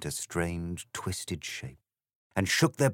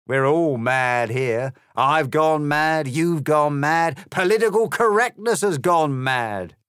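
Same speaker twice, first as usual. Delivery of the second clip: high, louder, cocky grinning cat; smug cat; 7 semitones higher, 8 dB louder than usual. Acted. The grinning cat answering Alice with a swagger. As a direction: toss it off like a swaggering pub cat. Cat character, building declaration.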